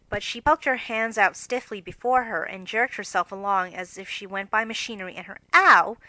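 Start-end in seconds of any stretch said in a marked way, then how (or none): 5.5-6.0 loud, wooden "ow" like a pin prick; badly acted stage cry; stiff ow. Shown as a demonstration. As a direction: yelp it stiffly, no real fear. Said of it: "more suggestive of pins being run into her".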